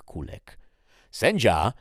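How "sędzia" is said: high, loud call for the judge. Acted. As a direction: summon the judge with a ringing call. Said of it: "zawołała śmierć".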